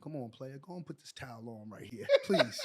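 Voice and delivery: low, soft, sinking almost to a murmur; imitated calm. Teammate talking down an angry naked player, imitated calm. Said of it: "he said it so calm".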